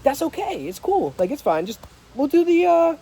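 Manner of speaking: bright high friendly voice; kind, upbeat. Shown as a demonstration. Assuring the cashier about the price match, bright and kind. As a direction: make the cashier feel at ease. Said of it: "You see the difference".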